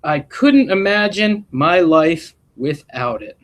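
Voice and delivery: gentle storybook read-aloud voice; expressive classroom story reading; reading to children. A narrator's reading. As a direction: read it like a picture book to a class. Reads picture book aloud.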